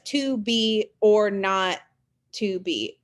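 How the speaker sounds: slow, measured "to be or not to be"; iambic stress. Shown as a demonstration. Teacher proving these are iambs, slow beat through "to be or not to be". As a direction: stress "be" and "not", pausing before the last "to be". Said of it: "those are iams because you have unstressed".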